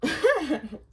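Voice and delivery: laughing. Real, not acted. Laughs mid sentence.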